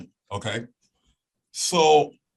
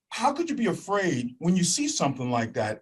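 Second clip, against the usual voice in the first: ordinary talk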